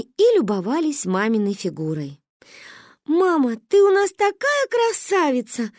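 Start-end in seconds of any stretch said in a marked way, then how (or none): 3.1-5.7 put-on high, gushing child's voice; adoring daughter breathing out praise; admiring little girl. A narrator's reading. Daughter praising her mother, admiring gush. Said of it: "выдохнула я".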